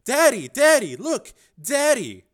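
put-on high, loud child calling; children calling their father in a dream; child shouting. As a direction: call out like an excited kid.

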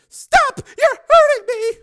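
very high, loud, drawn-out female cry; pained scream. A narrator's reading. Susan being hurt by Steele, screaming in pain. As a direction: scream for him to stop. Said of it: "she screamed with pain".

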